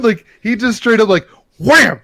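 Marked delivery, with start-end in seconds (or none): 1.1-2.0 loud punchy wham. Retold or imitated. Describing the stomp with a loud wham. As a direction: slam the word down.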